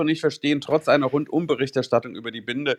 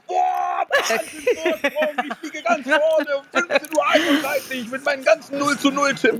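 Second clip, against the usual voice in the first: high, squealing mock triumph; mock excited gloating. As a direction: gush over the lead, hammed up. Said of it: "sagt dann um fünfzehn Uhr dreißig".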